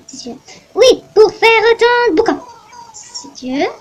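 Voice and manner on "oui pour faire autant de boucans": put-on loud, high cracking old lady voice; old lady complaining about tourists; shrill old lady. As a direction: squawk like an outraged granny. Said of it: "Je vais lui faire un bon accent".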